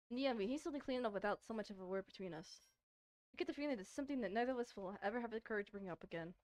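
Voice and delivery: ordinary talk, nothing marked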